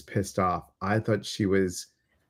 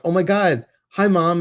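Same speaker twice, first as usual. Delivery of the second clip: excited; 6 semitones higher, 5 dB louder than usual. Retold or imitated. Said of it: "I would get so excited".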